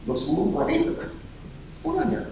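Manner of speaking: quiet voice climbing in confusion; hushed puzzlement. Acted. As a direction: wonder aloud under your breath. Peter puzzled by the glow.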